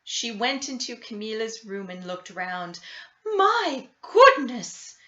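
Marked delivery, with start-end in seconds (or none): 3.2-5.0 high, loud, slow "my goodness"; a fussing old lady's exclamation; higher, slowed-down gasp of dismay. A narrator's reading. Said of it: "with a shake of her head".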